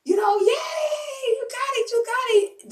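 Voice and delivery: high excited cheering cry; universe cheering. Pretend. The universe cheering the speaker on, high excited cry. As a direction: cheer like the whole universe is celebrating you. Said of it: "the universe is screaming at you".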